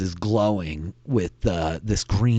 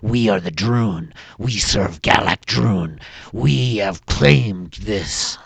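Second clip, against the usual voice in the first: defiant kobold; 7 dB louder than usual. Acted. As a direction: boom out the Droon's claim to the ship. Kobold leader asserts claim.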